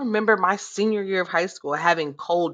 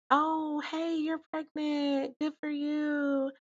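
mock sweet warning; 5 semitones higher, 7 dB quieter than usual. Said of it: "if they had said".

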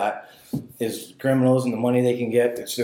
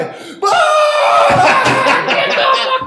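high, loud burst of laughter; shrieking laugh; 9 dB louder than usual. Real, not acted. Laughing hard at his own biker story, high and loud. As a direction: let a howling laugh break out.

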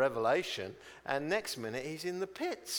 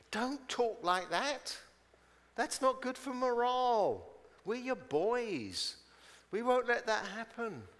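pleading reproach; 8 semitones higher than usual. Acted. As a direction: coax him out of it, pitched high. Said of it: "It's like he's saying".

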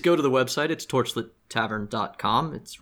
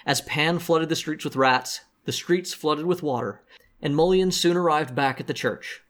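story narration; 3 semitones higher than usual. Acted. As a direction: read it like a show recap. Recap narration.

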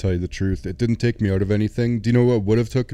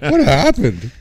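mock soldier; 7 semitones higher, 6 dB louder, slower than usual.

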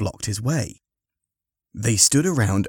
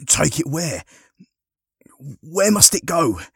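raised, higher pressing questions; urgent questioning; 8 semitones higher, 3 dB louder than usual. Acted. Harry questioning the injured man, urgent raised voice. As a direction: press a fading man for an answer.

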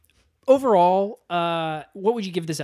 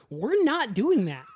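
higher, slow mock-appalled refusal; firm refusal; 6 semitones higher, slower than usual. Director turning down a lewd idea, firm and appalled. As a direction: draw out the objection, pitched up.

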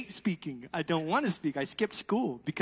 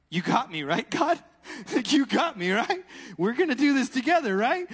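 higher, louder nervous laughing plea; louder nervous pleading to God while falling; anxious excited plea; 4 semitones higher, 5 dB louder than usual. Retold or imitated. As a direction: beg God for reassurance mid-jump. Nervous prayer to God mid-fall.

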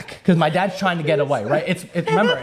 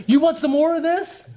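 otter taunt; 10 semitones higher than usual. Retold or imitated. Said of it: "hissing at him".

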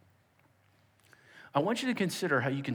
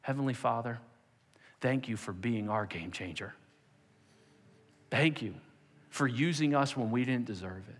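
prayer; 3 semitones lower than usual. Acted. Closing prayer.

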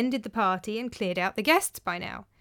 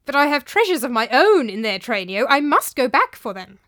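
insistent; 3 semitones higher, 7 dB louder than usual. Acted. Master insists on fetching treasures.

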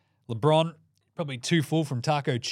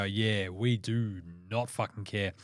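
low, slow, couldn't-care-less voice; mock indifference; 4 semitones lower, 6 dB quieter, slower than usual. Wizards back from Cancun shrugging off the season, mock indifference. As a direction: drag it out softly, totally uninterested.

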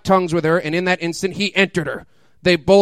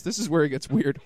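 ordinary talk

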